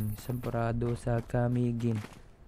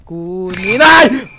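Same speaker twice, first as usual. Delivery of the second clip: hammed-up cry, much louder and higher on nay; mock dramatic shout; 5 semitones higher, 17 dB louder, much slower than usual. Player offering bottles to game mother, hammed-up shout. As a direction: bellow it like a grand offering.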